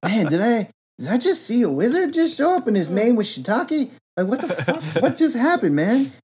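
high, dazed stoner voice; stoned kid. Retold or imitated. Stoned kid after the wizard vanishes, dazed and high. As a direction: play it baffled and high. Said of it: "the kid's super high and he's like".

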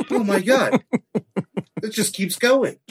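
ordinary delivery

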